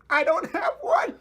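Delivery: very high, loud squeaky sob; hammed-up tearful confession; mock wailing. Ozpin confessing he has no plan, exaggerated wail.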